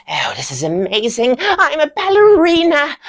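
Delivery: rising to a high, loud squeal; thrilled exclamation. Acted. Student becoming a ballerina, overjoyed. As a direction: burst with hammed-up delight.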